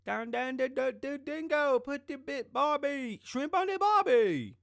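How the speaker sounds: high, loud hammed-up Australian accent; over-the-top Australian impression; mock Australian accent. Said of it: "get you a Aussie".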